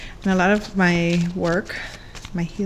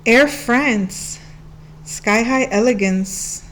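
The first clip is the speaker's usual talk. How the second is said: ordinary delivery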